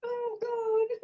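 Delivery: high, soft wheeze of laughter; breathless laughing. Real, not acted. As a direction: barely get the words out for laughing. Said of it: "I can't breathe for laughing".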